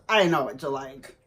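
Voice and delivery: a mock-deep husband line, smug and sure; imitating husband. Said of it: "He goes".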